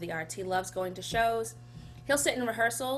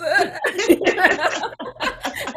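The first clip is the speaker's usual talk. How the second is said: long high laugh, close to tears; high laugh on the edge of crying; teary laugh; 10 semitones higher, 11 dB louder than usual. Real, not acted. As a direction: laugh it off through emotion. Laughing after sad story.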